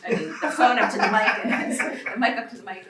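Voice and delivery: laughing through a slip of the tongue; loud, amused self-correction. Real, not acted. Amused slip while juggling phone and mic.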